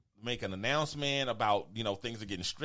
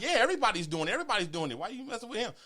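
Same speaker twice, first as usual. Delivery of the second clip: retold crowd protest; 11 semitones higher, faster than usual. Acting out customers speaking up.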